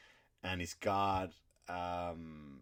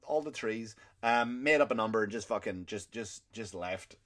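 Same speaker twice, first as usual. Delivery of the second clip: normal talking voice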